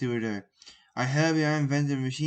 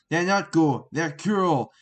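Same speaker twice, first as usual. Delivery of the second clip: raised, firm rebuke; stern loud; 4 semitones higher, 6 dB louder, slower than usual. Acted. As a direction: scold the kid loudly. Professor sternly disagrees.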